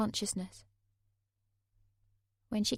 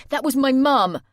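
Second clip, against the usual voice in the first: higher, louder panicked cry; panicked outburst; 6 semitones higher, 14 dB louder than usual. Acted. Jay panicking that his mum heard everything, loud. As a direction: burst out in panic.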